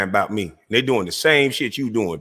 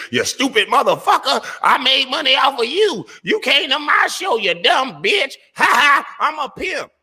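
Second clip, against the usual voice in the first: much higher, laughing, cackling mock yell; mocking imitation. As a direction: ham up the rival cussing someone out. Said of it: "you cuss 'em out and say".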